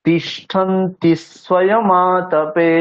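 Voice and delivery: slow তিষ্ঠন্তি স্বয়মাতপে, s carried onto তিষ্ঠন্তি; carefully slow, the s of স্বয়মাতপে joined to the word before; slow recitation demo. Shown as a demonstration. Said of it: "যেমন উচ্চারণ করছি দেখুন". (ধীরে তিষ্ঠন্তি স্বয়মাতপে, স আগের সাথে)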